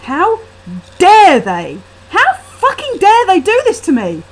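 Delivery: loud, high furious outburst; rage. A narrator's reading. Narrator fuming about family on the street, loud. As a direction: spit it out, shaking with fury. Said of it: "shaking with rage".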